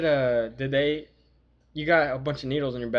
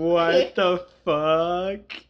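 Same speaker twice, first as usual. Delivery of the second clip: laughing; 4 semitones higher, 5 dB louder, much slower than usual. Real, not acted. Laughing reaction to accent.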